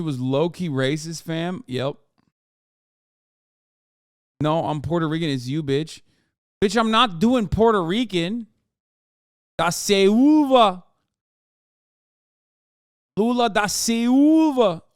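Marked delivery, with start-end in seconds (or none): none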